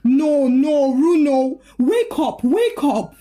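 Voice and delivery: wife crying over her husband. Acted. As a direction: beg him to wake up. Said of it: "Mimi cried as she shook him".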